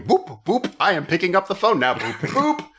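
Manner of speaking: high, goofy "boop, boop" with a laugh; mock the bad Italian dub; silly dub voice. Mocking the bad dub of Michael Winslow, high and goofy. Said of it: "dubbed by someone going".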